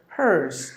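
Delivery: "hers" for hearse, R sounded; falling "hers" with an American R; American pronunciation. Shown as a demonstration. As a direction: say "hearse" American-style with a clear R. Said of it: "when it comes to American pronunciation".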